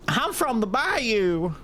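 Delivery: put-on slow, high drawling Southern accent. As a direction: play it up as a bayou Southern accent. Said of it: "they made her Southern as hell".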